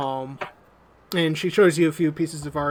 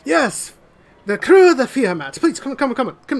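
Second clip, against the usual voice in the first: higher, louder eager nobleman; welcoming lord; 9 semitones higher, 6 dB louder than usual. Acted. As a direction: greet the crew with effusive welcome. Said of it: "And he says".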